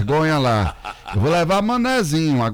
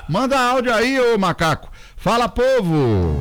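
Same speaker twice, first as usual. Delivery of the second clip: pitched-up on-air summons; calling out; 9 semitones higher than usual. Real, not acted. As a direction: hail the listeners, stretching the last call. Host calls out for listener audio.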